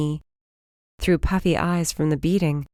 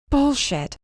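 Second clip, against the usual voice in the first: high sharp disbelief; a sharp high bullshit; sharp high retort; 9 semitones higher than usual. Acted. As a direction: call his bluff sharply. She calls out his lie.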